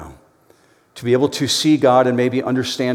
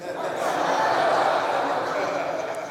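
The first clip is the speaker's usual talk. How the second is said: silent wheezing laugh; breathy real laugh; 8 semitones higher, 6 dB quieter than usual. Real, not acted. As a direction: laugh at the punchline under your breath. Laughs at own joke.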